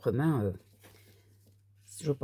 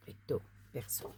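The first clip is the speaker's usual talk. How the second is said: whispered; 4 semitones lower, 16 dB quieter than usual. Shown as a demonstration. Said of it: "repeating the card label, hushed".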